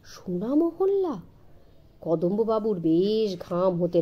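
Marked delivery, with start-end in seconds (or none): none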